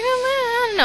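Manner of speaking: high, unsure mock boyfriend; stuttering boyfriend. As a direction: squeak out a timid dodge. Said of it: "you better not be stuttering again".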